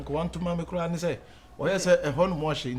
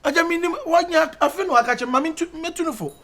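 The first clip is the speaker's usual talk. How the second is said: high, urgent appeal to father; stumbling, high-pitched plea; loud pleading; 12 semitones higher, 9 dB louder than usual. Acted. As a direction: plead loudly at a raised pitch.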